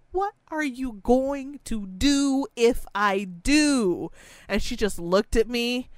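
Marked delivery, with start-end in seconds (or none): 0.1-4.1 high, slow, daring question; slow challenge. Retold or imitated. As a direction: call her bluff slowly. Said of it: "I was like".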